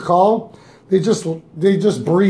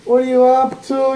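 slow, higher, drawn-out mock sweet voice; flirty caller; 6 semitones higher, slower than usual. Host imitates the private caller's sugary chat: slow and higher. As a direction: stretch each word out like a coy caller. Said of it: "then she's like".

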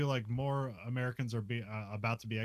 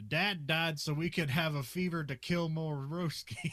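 lightly voiced dumb tough guy, higher and slow; a hint of a movie meathead, drawn out; mock dim action hero, slow and a bit higher; stupid man voice; 6 semitones higher than usual. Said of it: "the stupid man voice".

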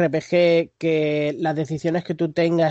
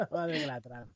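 laughing; 3 semitones lower, 14 dB quieter than usual. Real, not acted. Still laughing after the joke.

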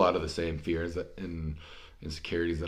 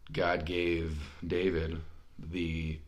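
ordinary talk

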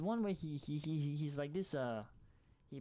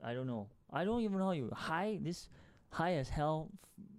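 usual speaking voice

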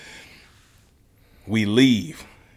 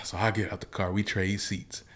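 ordinary talk, nothing marked

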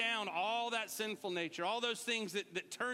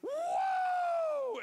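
high loud whoop; joyful shout; 6 dB louder than usual. Retold or imitated. Preacher acting out a joyful shout, high and loud. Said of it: "it's like".

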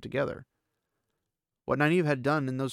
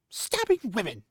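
indignant outburst; 9 semitones higher than usual. Acted. Nynaeve voiced, angry.

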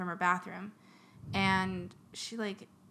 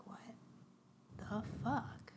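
hushed. Retold or imitated. Lady's hushed alarm.

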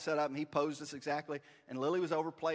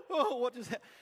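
high-pitched imitation of a stunned mom; shocked mother; 8 semitones higher, 4 dB louder than usual. Mom after her son told off the photographer, shocked. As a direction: squeal it in mock shock. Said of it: "my mom was like".

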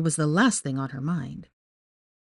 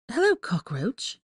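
slow, hissed back in contempt; hissing; 6 semitones higher, 3 dB quieter, slower than usual. A narrator's reading. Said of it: "she hissed back".

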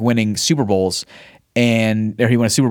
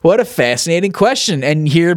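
normal talking voice

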